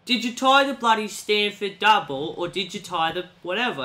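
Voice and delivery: demanding to know which knot, British; British mentor grilling. Retold or imitated. Angry mentor asks about the knot, British voice.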